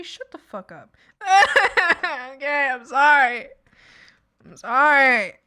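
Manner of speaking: ordinary talk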